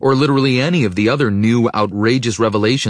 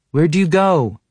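high, louder call from another room; sleepy call; 10 semitones higher, 4 dB louder than usual. A narrator's reading. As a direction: call out, still half asleep. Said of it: "her still sleepy voice carrying over".

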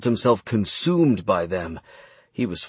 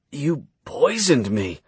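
strained, slow, pitched up in pain; pained grunt; 5 semitones higher, slower than usual. Acted. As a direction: force it out through the pain. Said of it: "he grunted".

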